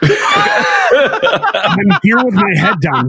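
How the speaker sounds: slow, high laughing reply; sheepish laugh after being called out. Real, not acted. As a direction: laugh it out slowly. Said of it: "[name], are you texting?".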